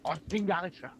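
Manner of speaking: high frantic shout, no no no. Acted. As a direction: call out sharply to stop it. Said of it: "the figure just shouts out".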